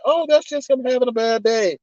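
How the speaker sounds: high, airy put-on excusing voice; mock dismissive excuser. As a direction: imitate someone brushing it off sweetly. Mimics people excusing the yeller.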